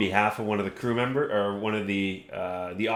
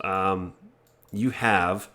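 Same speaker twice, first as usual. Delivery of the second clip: ordinary talk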